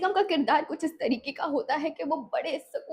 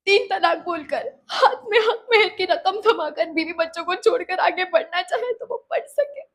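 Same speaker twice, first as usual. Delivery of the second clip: ordinary talk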